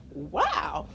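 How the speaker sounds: plain reading voice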